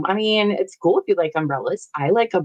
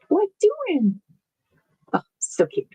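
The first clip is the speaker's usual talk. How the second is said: put-on baby talk; 13 semitones higher than usual. Cooing at bird video.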